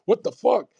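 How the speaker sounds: sudden high exasperated cry; incredulous outburst. Real, not acted. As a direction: throw it up in disbelief. Outraged nobody records his life.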